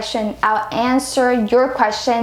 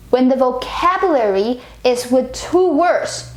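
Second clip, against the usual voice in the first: ordinary talk, nothing marked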